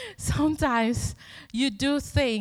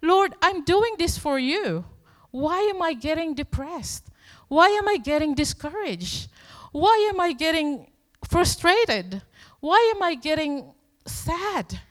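higher, louder, asking Lord why in dismay; pleading complaint to God; 7 semitones higher, 5 dB louder than usual. Retold or imitated. Pastor voicing his tired prayer to God, pleading and higher. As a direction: plead like a worn-out servant. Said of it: "And then I ask".

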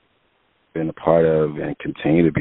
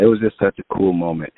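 usual speaking voice

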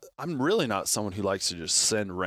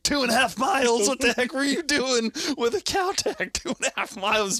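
much higher, louder outraged disbelief; hammed-up furious disbelief, very high; outraged shriek; 6 dB louder than usual. Outraged at long pack out.

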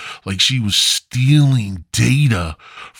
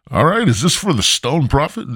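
higher, bright-eyed clerk voice; 7 semitones higher than usual. Retold or imitated. Comic imitating the chipper drive-thru clerk, higher. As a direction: play the overeager drive-thru kid.

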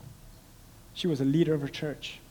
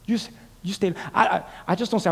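usual speaking voice

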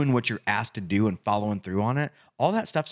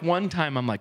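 ordinary delivery

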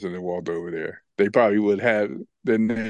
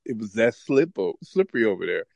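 ordinary delivery

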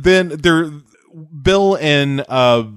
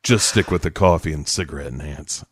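mock sulky husband; 6 semitones lower than usual. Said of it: "acted quote".

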